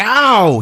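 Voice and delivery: high, loud cry of pain; pained yelp. Retold or imitated. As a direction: yelp as if stabbed in the hand. Blade lands in his hand.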